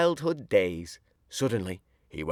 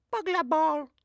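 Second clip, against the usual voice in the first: put-on very high lisping toddler "Burglar Ball"; a toddler mangling "Burglar Bill" into "Ball"; baby voice. A narrator's reading. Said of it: "says the baby".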